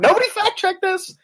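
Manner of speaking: a listener reacting in hammed-up disbelief; mock disbelief. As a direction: act stunned that nobody checked it. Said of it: "You'd go".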